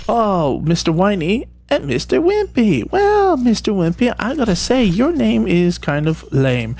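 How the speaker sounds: high, exaggerated swooping talk to toys; playful mock-address. Player teasing Mister Wimpy about the name, playful high voice. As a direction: pitch it way up, slowing toward the end. Teasing toy elves in play.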